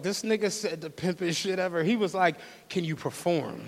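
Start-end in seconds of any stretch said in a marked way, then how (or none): 2.7-3.6 businesslike coach. Retold or imitated. Pimp asks robbed girl to work, businesslike and low-key. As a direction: imitate a low, soft, matter-of-fact check. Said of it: "Said it like she was an Olympic gymnast".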